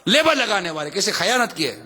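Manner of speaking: usual speaking voice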